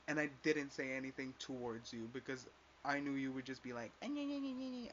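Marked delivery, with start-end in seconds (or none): 4.0-4.9 high mocking nyeh-nyeh; a much higher, taunting imitation of her nagging; mocking whiny voice. Mimicking the girl she can't stand, high whiny taunt. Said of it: "and your voice is annoying".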